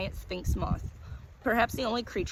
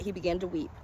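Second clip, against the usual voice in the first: plain reading voice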